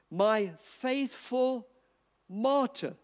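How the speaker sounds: "faithful" drawn out very slowly; slow, deliberate stress on "faithful". Shown as a demonstration. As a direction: linger on "faithful", then "martyr". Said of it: "listen to this".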